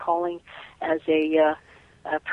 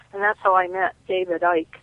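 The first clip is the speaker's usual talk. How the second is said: usual speaking voice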